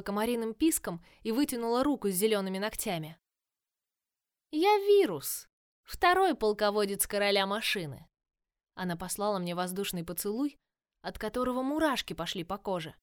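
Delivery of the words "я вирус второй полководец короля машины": loud, gleeful taunting villain voice; mock the heroes with cheerful menace; playful villainess. Villain Virus speaks.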